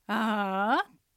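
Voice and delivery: long, slow "あー" sagging then rising; disappointed dip. Shown as a demonstration. Teacher likens third tone to disappointment, dipping "あー". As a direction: let "あー" sink as if let down, then lift. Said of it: "がっかりするような".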